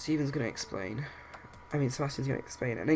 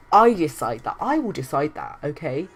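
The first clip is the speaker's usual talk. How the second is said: emphatic, louder mother laying down authority; acted anger; 8 dB louder than usual. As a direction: assert it crossly, punching the first words. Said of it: "getting really angry and really riled up".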